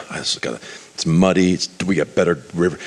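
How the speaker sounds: low acted grumble; bad-mood grumbling. Naaman climbing into muddy water, grumbling in a bad mood. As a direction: complain to yourself, pitched low. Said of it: "he's in a bad mood".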